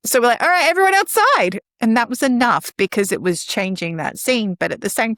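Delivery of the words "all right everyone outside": put-on raised call to a group of kids, high; teacher rallying the class outdoors. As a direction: call everyone outside. Calling kids outside.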